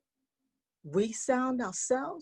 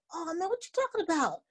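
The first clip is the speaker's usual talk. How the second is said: high, quick-starting friendly protest; encouraging cricket; 7 semitones higher than usual. Acted. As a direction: pipe up like a supportive little cricket. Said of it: "And Cricket said".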